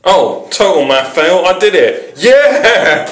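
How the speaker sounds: loud, high cheer of victory; celebrate, climbing to a big high whoop; real jubilant yell; triumphant. Real, not acted. Celebrates discovering he made the time.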